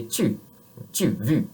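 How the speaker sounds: tu and vu with rounded French u. Shown as a demonstration. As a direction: round the lips on tu. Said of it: "when you say".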